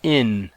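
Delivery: "in." spelled out as plain "in", no h sound; preposition in. Shown as a demonstration. Teacher naming the Greek preposition: clear "in.". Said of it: "it's a static preposition".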